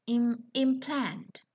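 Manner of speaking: "implant" slowly, leaning on PLANT; stress on second syllable. Shown as a demonstration. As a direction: verb form "implant", imPLANT. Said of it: "It has different stress".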